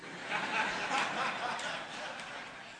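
breathy laughter at his own punchline; amused unvoiced laughter; long soft laugh. Real, not acted. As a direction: laugh quietly and let it run. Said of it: "teller laughing at punchline".

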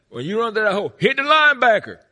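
loud, high barking coach voice; yelling coach. Retold or imitated. Coach yelling instructions at a ten-year-old fullback. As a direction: bark it like a football coach. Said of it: "the coach said".

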